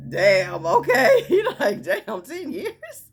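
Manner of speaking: high, laughing disbelief; imitated astonishment. Host hearing ten years single, imitated amused shock. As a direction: react stunned, voice jumping up through laughter.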